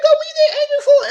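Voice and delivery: very high, laughing mock question; laughing taunt. To the angry castmate, a very high laughing taunt. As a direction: needle her through laughter. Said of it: "I said".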